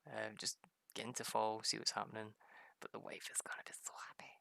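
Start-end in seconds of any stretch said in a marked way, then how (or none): 2.8-4.3 fast, soft whispered aside. Real, not acted. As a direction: whisper it to yourself quickly.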